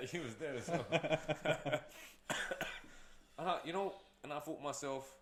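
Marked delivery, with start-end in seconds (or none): 0.9-3.3 airy wheezing laugh; breathy silent laugh. Real, not acted. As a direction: laugh under the breath at the absurdity. Laughing at the rude lady.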